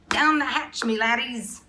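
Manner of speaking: high, loud pirate roar; a rowdy captain's toast; yelled toast. A narrator's reading. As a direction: bellow it and pound the table. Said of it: "pounded his fist on the table and yelled".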